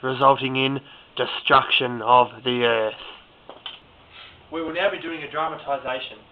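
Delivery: ordinary talk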